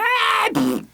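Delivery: short, very high shriek; a hammed-up horror-movie scream; mock scream. Hooker screaming on the phone as she's attacked, a high mock shriek.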